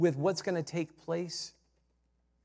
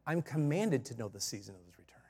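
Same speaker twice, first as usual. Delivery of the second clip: whispered reply; 7 semitones lower, 6 dB quieter than usual. Acted. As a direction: whisper the whole line, unvoiced and low. Coaching a reply to mockers.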